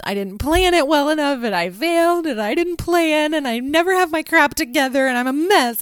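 high, loud, distraught self-blame; emotional outburst. Retold or imitated. To her husband, retelling her breakdown as a mom, distraught. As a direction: pour out the failure in a rush. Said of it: "emotionally saying it out loud".

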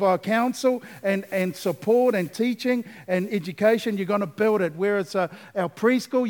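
rally them with emphatic preaching; impassioned preaching. Real, not acted. Preacher rallying church to build the house, impassioned.

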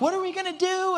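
high, panicked cry; panicked disciples. Retold or imitated. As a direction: disciples on the boat panic about the waves, high and frightened. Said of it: "they're freaking out".

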